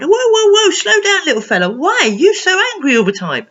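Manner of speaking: high, loud whoa, easing into a question; urgent calming-down. Acted. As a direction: rein in a runaway little one. Coach grabs and stops Angry.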